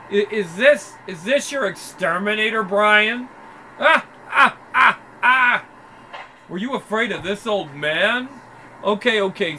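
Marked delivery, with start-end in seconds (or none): 3.8-5.7 high loud mocking cackle. Thug cackling at Brian's exterminator, high and loud. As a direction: cackle to ridicule the old man.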